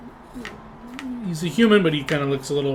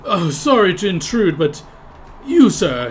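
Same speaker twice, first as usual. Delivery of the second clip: loud eager stranger; half-orc stranger; 6 semitones higher, 8 dB louder, slower than usual. Acted. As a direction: barge in as a pushy half-orc. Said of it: "interjects himself ... and says".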